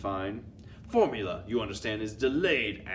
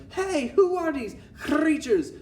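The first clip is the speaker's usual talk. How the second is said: very high, loud mock outrage; hammed-up high-pitched yell; teachers' yell; 6 dB louder than usual. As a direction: cry out like a demented teacher. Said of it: "the poor demented teachers is yelling".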